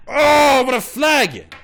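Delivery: ordinary talk, nothing marked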